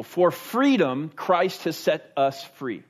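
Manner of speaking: slow, weighty reading of the verse; slow scripture reading. Shown as a demonstration. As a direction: let each word land slowly. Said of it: "let me pause there".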